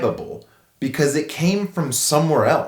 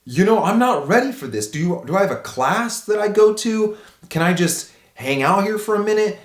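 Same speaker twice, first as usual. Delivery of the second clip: high, rushed panic asking for a moment; panicked plea; 8 semitones higher than usual. Retold or imitated. New father holding his baby, pleading for time in panic. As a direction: beg for time, voice up and scared. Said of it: "I'm like".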